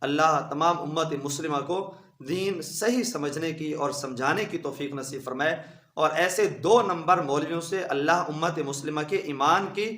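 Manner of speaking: ordinary delivery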